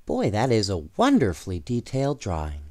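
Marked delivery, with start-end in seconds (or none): none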